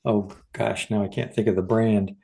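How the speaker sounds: ordinary delivery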